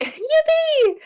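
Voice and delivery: high, gleeful yippee; high-pitched little whoop; mock cheer. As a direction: cheer it out high after a laugh. Celebrating the escape.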